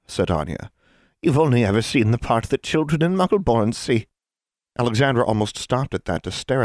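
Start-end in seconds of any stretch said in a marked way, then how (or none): 1.2-4.1 lightly voiced scornful witch; a hint of a smug hag; condescending hag. Hag voice in reading.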